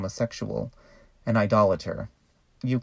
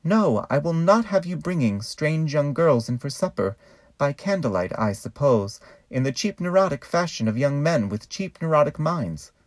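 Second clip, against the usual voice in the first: pious saint's scolding; 4 semitones higher than usual. Pretend. Saint Catherine's head scolding the young man, higher and pious. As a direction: scold him like a prim saint. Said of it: "The head will scream".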